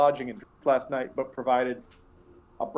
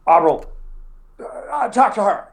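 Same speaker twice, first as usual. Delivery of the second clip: loud, higher call, a little flustered; 8 semitones higher, 7 dB louder than usual. Acted. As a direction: hand her off and hurry away. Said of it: "And he just goes".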